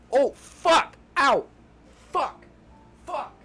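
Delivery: real pain outburst; sudden foot cramp pain. Real, not acted. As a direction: cry out as the cramp hits. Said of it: "Sorry, foot cramp".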